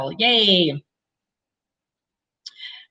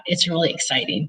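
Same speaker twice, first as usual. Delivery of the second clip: usual speaking voice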